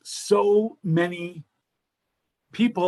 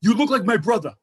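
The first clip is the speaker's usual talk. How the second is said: imitating the cab driver; 2 semitones higher, 3 dB louder than usual. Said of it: "He goes".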